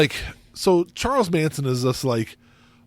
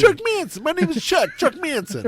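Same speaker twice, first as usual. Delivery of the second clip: put-on high, thick New Jersey tough-guy accent; hammed-up New Jersey thug voice; New Jersey Chuck; 12 semitones higher than usual. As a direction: introduce yourself like a Jersey goon. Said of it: "a guy named Chuck that lived from New Jersey".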